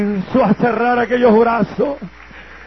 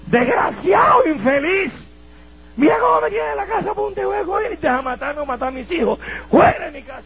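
furious mother yelling; 10 semitones higher than usual. Retold or imitated. Mother shouting her son out of her house, furious. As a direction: scream him out of the house like an enraged mother.

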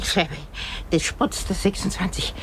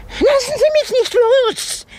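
panicked cry; 7 dB louder, slower than usual. Acted. Panics while being helped up.

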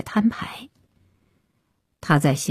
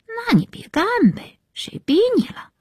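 much higher-pitched sulky mumble; grumbling. A narrator's reading. Daughter talks back to scolding mother, grumbling. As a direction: grumble back in a small, high girl's voice. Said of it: "杨小梅嘟囔着".

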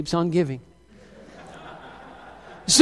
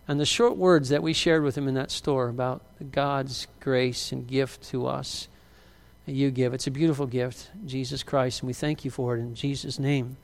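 soft, low praying voice; prayer; 4 semitones lower, 4 dB quieter than usual. Real, not acted. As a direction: pray gently and quietly over someone. Leads a prayer for Marcus.

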